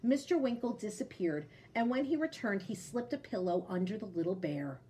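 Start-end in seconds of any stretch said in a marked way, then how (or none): none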